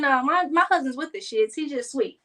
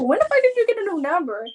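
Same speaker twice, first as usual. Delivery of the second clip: higher and faster, outraged disbelief; louder shocked repeat; 9 semitones higher, 3 dB louder, faster than usual. Retold or imitated. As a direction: fire it back, a notch more stunned. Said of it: "I'm like".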